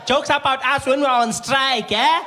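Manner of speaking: high, loud, drawn-out taunt; accusing receptionist. Retold or imitated. Receptionist confronts comedian about jokes, high accusing shout. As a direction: accuse loudly, stretching the words.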